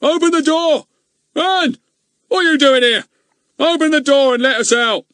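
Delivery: high, loud furious shouting; loud angry shouts. Acted. Goon shouting at Ern to open the door. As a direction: bellow at the boy to open up.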